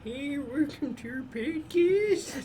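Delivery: put-on high, slow old-timer drawl; old prospector. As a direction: croak it like a cranky old miner. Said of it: "That's what you sounded like just now".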